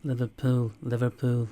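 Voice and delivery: loud, labored stab at a Liverpool accent; attempted Liverpool accent. Shown as a demonstration. Replying to a viewer about voice impressions, attempted Liverpool accent. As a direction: have a shaky go at Scouse, Liverpool style. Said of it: "learn how to do the Liverpool accent".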